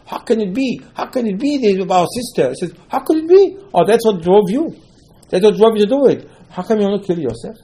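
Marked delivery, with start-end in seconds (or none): 0.1-2.5 high, incredulous appeal; indignant protest. Acted. Brothers protesting what was done to their sister, indignant. Acted outrage over the sister. 2.9-7.6 high, forceful accusing questions; indignant reproach. Acted. Father confronting sons over Yosef, indignant.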